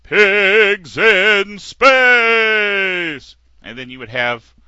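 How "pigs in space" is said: loud, hugely drawn-out announcer shout. Retold or imitated. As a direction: stretch it like a booming TV announcer. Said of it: "And the announcer would say".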